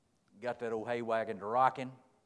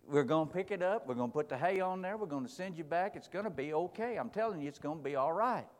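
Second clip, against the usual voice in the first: higher, firm and soothing promise; insistent reassurance; 6 semitones higher than usual. Acted. Man promising the fretting boy all will be fine, higher and soothing. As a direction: talk him down, it's all fixed.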